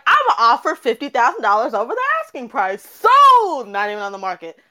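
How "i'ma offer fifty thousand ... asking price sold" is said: fast, loud, high auction frenzy; excited bidding. Retold or imitated. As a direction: rattle it off like a frantic bidder. Buyers bidding frantically, retold rant.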